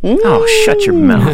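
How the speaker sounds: high, slow mock outrage. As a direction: squeal it in fake disgust. Mock outrage at warm weather.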